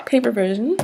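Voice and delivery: ordinary delivery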